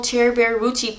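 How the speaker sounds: ordinary delivery, nothing marked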